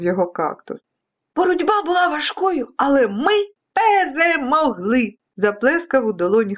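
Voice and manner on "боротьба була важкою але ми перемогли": put-on high, proud childlike voice; high-pitched storybook triumph; triumphant boy. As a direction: declare victory, stretching the last word. Boy proudly announces victory, clapping.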